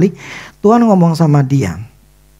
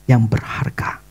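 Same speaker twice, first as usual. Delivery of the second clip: reverent whisper; 5 semitones lower, 6 dB quieter, slower than usual. Acted. God's words, hushed reverently.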